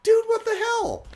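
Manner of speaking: very high squeaky cartoon-dog voice; the dog is stunned and offended; high-pitched talking dog, baffled; bewildered dog. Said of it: "is like".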